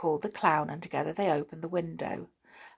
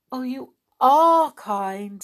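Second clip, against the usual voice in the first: grateful doll voice; 7 semitones higher, 8 dB louder, slower than usual. A narrator's reading. Amelia grateful.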